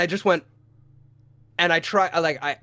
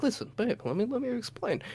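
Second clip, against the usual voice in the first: softer, gentle explaining; calm and soft; 6 semitones higher, 10 dB quieter than usual. Retold or imitated. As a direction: talk her down softly. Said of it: "like an adult".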